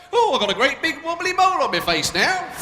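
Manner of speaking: high, loud mock old person. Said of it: "they wonder about us".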